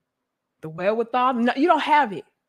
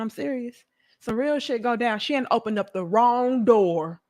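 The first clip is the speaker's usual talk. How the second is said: normal talking voice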